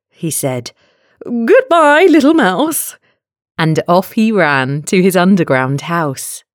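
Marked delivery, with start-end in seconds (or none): 1.2-3.0 high, loud, hammed-up alarm; storybook fox in a fright; panicked farewell. A narrator's reading. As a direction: call it out high as you flee.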